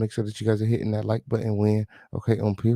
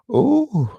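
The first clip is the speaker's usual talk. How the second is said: high loud ooh; shocked ooh; 6 dB louder than usual. Real, not acted. Host reacting to gossip, loud shocked ooh. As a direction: gasp it out scandalized.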